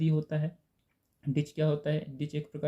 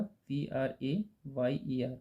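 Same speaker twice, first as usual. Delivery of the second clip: slow, letter by letter spelling; 4 dB quieter, much slower than usual. Shown as a demonstration. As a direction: spell each letter out slowly for the class. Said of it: "prayer का उत्तर क्या होगा?".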